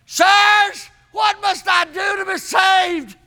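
high, urgent plea; pleading cry. Acted. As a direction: beg desperately to be saved. Jailer begging Paul and Silas.

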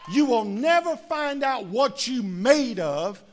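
full-force shouted preaching, rising. Acted. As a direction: drive it home to the congregation.

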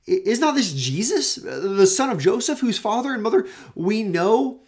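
high, loud indignant challenge; grumbling crowd. Acted. Grumblers questioning Jesus' claim, in loud high indignation. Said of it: "Listen, they grumble".

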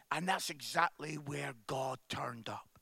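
hushed, breathy-free whisper for emphasis. Real, not acted. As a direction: lean in and whisper it. Preacher whispers key line.

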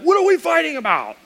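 high-pitched exasperated question; mock baffled outburst; exasperated bewilderment. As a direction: throw it up high, baffled by the whole fight. Retold bewildered marital argument.

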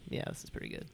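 whispered under the breath. Retold or imitated. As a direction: breathe it out without voice. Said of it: "And I was like".

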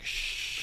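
hissed shh without voice; shushing. Retold or imitated. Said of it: "I think I said, like".

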